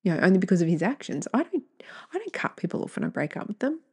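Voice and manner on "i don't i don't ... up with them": fast, breathless whispered aside; confiding whisper, fast. Real, not acted. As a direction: drop to a quick, higher whisper. Aside about cutting people off.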